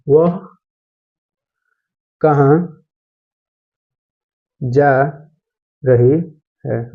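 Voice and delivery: each word spaced out with long pauses, very slow; slow, spaced-out classroom dictation. Shown as a demonstration. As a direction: dictate it word by word for students to write. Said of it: "teacher dictating sentence word by word".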